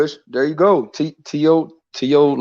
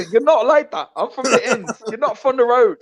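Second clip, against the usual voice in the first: put-on much higher accented voice with laughter; imitating Tunde; 10 semitones higher than usual. Retelling Tunde mocking Pat, high and laughing. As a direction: ham up the trainer's taunt. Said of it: "He said".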